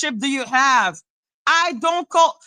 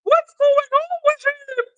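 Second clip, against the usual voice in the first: high, whiny fake-sweet concern; high, mocking put-on voice asking after him; 11 semitones higher than usual. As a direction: parody someone pretending to care. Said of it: "And then that thing".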